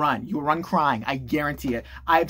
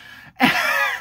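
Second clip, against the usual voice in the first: high breathless burst of laughter. Real, not acted. Comedian after the absurd birth bit, laughing. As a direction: crack up at your own joke.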